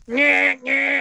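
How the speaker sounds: loud, high truck horn honks; imitated truck horn. Trucker honking at the driver, imitated horn blasts. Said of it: "on the horn".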